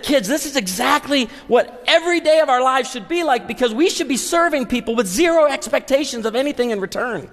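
put-on high-pitched, rousing speech to the kids; charged-up dad, carried away; fired up. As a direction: preach it excitedly to your children. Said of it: "I'm fired up".

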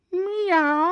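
thin, high pleading meow; a starving cat begging; pathetic high meow. A narrator's reading. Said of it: "she said pathetically".

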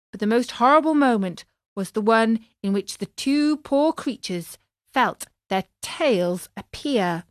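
ordinary delivery, nothing marked

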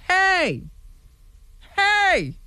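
high-pitched indignant "hey"; mock-angry exclamation; indignant outburst. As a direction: cry out in protest, pitch jumping up. Acting out an angry argument.

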